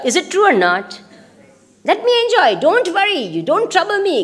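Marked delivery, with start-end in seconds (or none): 1.9-4.2 high-pitched mock protest; pleading protest. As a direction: brush off the nagging in a higher voice. Body protesting against the intellect.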